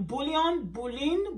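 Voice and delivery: ordinary delivery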